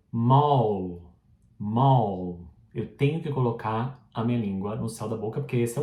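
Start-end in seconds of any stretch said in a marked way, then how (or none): 0.1-2.5 "mall" closed with a clear L; final L sounded. Shown as a demonstration. Teacher modelling the final L in "mall". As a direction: end "mall" with the tongue up. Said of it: "Outro exemplo".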